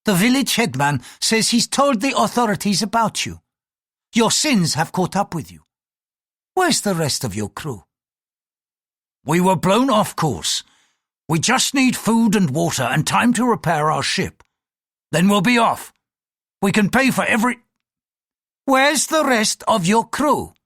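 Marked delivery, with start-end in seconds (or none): 0.1-5.7 higher-pitched priest passing judgment; accusing clerical voice; threatening priest. Acted. 9.2-17.6 raised, insistent pilot voice; higher-pitched, pressing explanation; pilot pleading his case. Acted. 18.7-20.5 high, slow, insistent demand; angry demand. Acted. Priest cuts in, repeats question.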